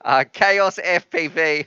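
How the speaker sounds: ordinary talk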